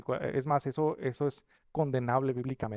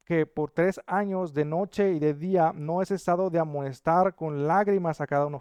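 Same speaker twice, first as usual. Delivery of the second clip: scripture reading; 3 semitones higher, 5 dB louder than usual. Acted. Pastor reads Acts to the church, loud and solemn. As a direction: proclaim the verses to the congregation.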